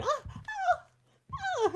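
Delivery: high mock scream; imitating her own high-pitched scream; retold scream. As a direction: squeal like a wasp is on you.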